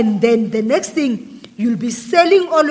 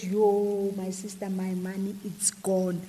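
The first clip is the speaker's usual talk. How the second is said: quiet despairing plea; 3 semitones lower, 10 dB quieter than usual. Retold or imitated. Retold plea to friend.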